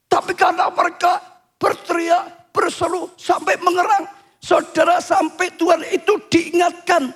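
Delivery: high-pitched, earnest sermon delivery; impassioned preaching. Acted. Preacher explains cries reminding God of his promise, impassioned preaching. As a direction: insist on it in emphatic phrases.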